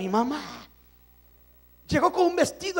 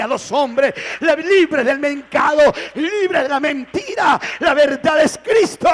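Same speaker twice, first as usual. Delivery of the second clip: full-force preaching; 9 dB louder than usual. Acted. Preacher proclaiming Christ to his brothers, full-force preaching. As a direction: preach it to the rafters.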